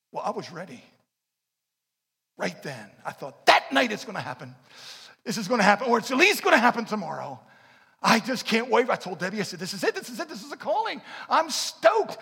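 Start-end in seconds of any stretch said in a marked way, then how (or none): 3.5-8.9 eager excitement. Retold or imitated. Young man thrilled by prophecy. 9.6-12.2 higher and building to loud; can't contain the thrill; stoked excitement. Retold or imitated. Young husband telling his wife he's stoked, rising excitedly.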